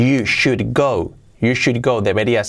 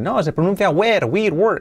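higher-pitched mock correction of "were"; mimicking objectors; 6 semitones higher than usual. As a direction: play the nitpicker correcting "were". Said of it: "que os dirán".

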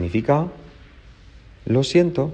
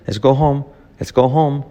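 fast, run-together LET'S GO HOME; quick natural take; 5 semitones higher, much faster than usual. Shown as a demonstration. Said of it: "mirado rápido es así".

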